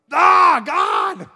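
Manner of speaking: loud, high mock cry of frustration; exasperated shout. Praying husband crying out to God, mock-exasperated shout. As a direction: shout it up at the sky, fed up.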